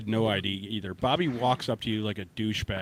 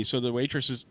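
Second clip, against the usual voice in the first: usual speaking voice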